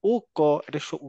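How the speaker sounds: ordinary talk, nothing marked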